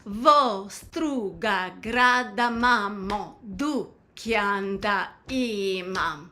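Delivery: slow, drawn-out syllables in song rhythm; spoken in rhythm. Shown as a demonstration. Teacher saying lyrics for learners to repeat, rhythmic speech. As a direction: speak the lyric slowly to the beat, unsung. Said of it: "I'll say it in the rhythm of the song".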